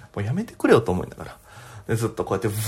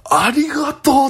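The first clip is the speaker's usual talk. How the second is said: high, drawn-out cry of thanks; hammed-up overflowing gratitude; hugely grateful; 13 semitones higher, 4 dB louder, slower than usual. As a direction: stretch it out high in delighted relief. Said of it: "めちゃくちゃギャルに感謝して".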